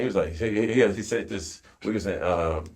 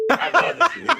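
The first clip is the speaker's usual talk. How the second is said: laughing impression; 3 dB louder than usual. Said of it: "He's like".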